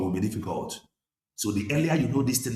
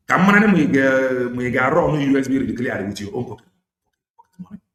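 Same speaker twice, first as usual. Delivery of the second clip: loud warning; 3 semitones higher, 9 dB louder than usual. Real, not acted. Warning followers about funding.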